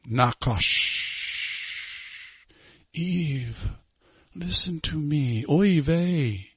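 soft, distant tempter voice; hissing serpent voice. Acted. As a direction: coax like a whispering serpent. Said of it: "can't you hear the Nakash in the background? Hissing?".

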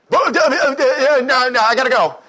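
high, loud mock panic; flustered escape. Telemarketer fleeing an offer of prayer, high mock-flustered blurt. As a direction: blurt it high, desperate to get away.

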